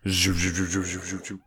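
repeated vocal whoosh effect; imitated zooming noise. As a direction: mimic a cartoonish teleport noise. Imitating character zooming out of room.

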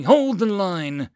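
loud, slow, high rallying cry; sudden yell. Acted. Lieutenant repeats order to retreating marines, yelled. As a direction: stretch it out as a battlefield yell. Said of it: "Karath yelled suddenly".

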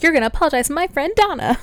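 high, fast mock outburst; imitated yelling. Host as Craig scolding someone on the phone, mock yelling. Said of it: "starts yelling at the person".

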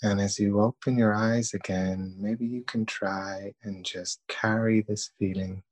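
calm, even meditation voice with long pauses; guided meditation. Acted. Leading listeners through breathing and self-thanks, calm guided meditation. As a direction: guide them gently, leaving long silences between phrases.

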